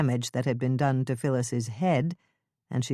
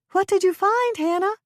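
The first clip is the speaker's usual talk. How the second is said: high woman's voice calling down; Lisa calling out; 5 dB louder than usual. Acted. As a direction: call down anxiously. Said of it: "Lisa called out".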